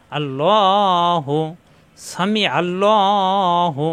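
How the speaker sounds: "আল্লাহু" drawn out slowly with a pressed throat ain; slow, drawn-out Quranic "সামি আল্লাহু". Shown as a demonstration. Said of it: "কণ্ঠ দিয়ে চাপ দিয়ে উচ্চারণ করতে হবে".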